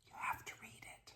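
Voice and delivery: urgent hushed whisper. Retold or imitated. Rector whispering to the reader across the aisle. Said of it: "and said".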